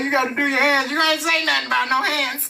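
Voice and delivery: high, indignant retelling; imitating the quarrel; mock stern dad then kid protest. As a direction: argue back like a kid. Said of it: "You like".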